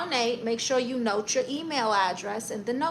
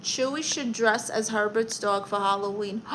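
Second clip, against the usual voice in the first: ordinary talk, nothing marked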